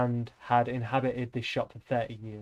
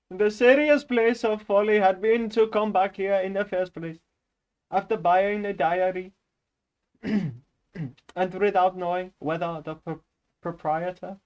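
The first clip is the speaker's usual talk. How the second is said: put-on higher-pitched Indian-accented reading; 5 semitones higher than usual. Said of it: "I can't do that".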